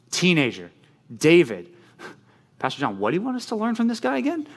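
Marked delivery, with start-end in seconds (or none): 2.6-4.5 high, fast voicing of a puzzled listener; a light mock question from the audience. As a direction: pitch it up like a confused kid in the pews. Congregant's imagined question.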